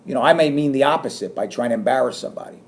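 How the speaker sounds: normal talking voice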